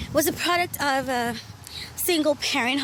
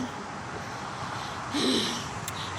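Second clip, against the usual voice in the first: weary sigh; 7 dB quieter than usual. Retold or imitated. Reacting to being teased.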